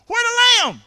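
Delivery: hesitant uh-uh-uh, then a high shrieking "where the lamb?"; stammering then squeaky yell. Pretend. Preacher hamming up Isaac's stammer and squeaky cry. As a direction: stall nervously, then blurt it out high and loud. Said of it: "can we do it again".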